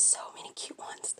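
a soft whispered aside. Real, not acted. Unvoiced aside about friend.

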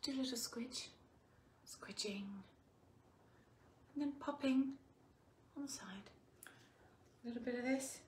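soft whispered plating commentary; whispering. Real, not acted. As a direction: whisper it like a cooking ASMR. Plating food, narrating softly.

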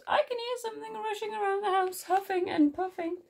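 very high small-mouse voice; quick, high report of a strange noise; anxious Little Mouse. A narrator's reading. Little Mouse reports night noises to Big Mouse, high and urgent. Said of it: "called Little Mouse".

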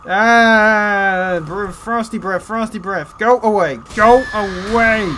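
high, loud frantic go away; panicked pleading. Real, not acted. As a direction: beg the attack off in real panic. Frosty breath attack incoming, panicking.

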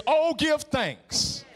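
"Oh, give thanks" stretched very slowly, the "Oh" held long; slow drawn-out quote. Retold or imitated. Preacher quoting the psalmist to the church, drawn out. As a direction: savour the psalm line, very slow. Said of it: "He says".

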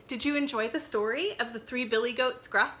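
ordinary delivery

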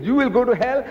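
high-pitched, rising "You will go to hell"; mock accuser, pitched well up; acted accusation. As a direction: throw the accusation out high, lifting at the end. Acted tit-for-tat damning, first side.

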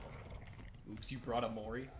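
ordinary talk, nothing marked

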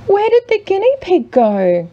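put-on high, loud, worried children's cry. A narrator's reading. Children calling out as they search for the lost guinea pig, worried cry. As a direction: call out like panicked kids. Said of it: "cried the children".

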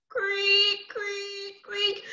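high, stretched creaking noise; strange rope creak. Acted. Storyteller voicing the old swing rope, high creaks. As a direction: imitate an old rope straining, pitched high. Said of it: "began to make a very strange noise".

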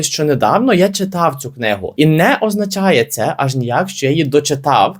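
usual speaking voice

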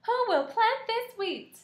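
high, slow, loud hen call; hen crying out. Acted. The hen begs the lazy animals for help, high and loud. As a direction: cry out as the little red hen. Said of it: "cried the little red hen".

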